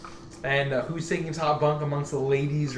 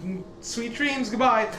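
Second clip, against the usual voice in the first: high, bright innkeeper voice; Elise voice; 9 semitones higher, 3 dB louder than usual. Acted. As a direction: play the innkeeper high and chirpy.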